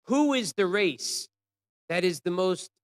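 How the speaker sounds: demanding retold call; guards calling out. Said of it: "the guards would call out".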